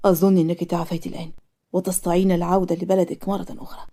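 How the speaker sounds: low, soft formal doctor voice; doctor character. Acted. Doctor tells his patient she has recovered, low and formal. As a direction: pitch it down, quiet and formal like a doctor.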